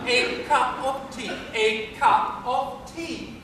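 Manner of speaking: A CUP OF TEA in distinct words, slowing on repeat; careful model pronunciation. Shown as a demonstration. Professor drills his pupil, deliberate distinct words. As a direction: clear "of" and long EE in "tea". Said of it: "Try it again, Eliza".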